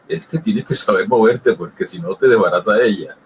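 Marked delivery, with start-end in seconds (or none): none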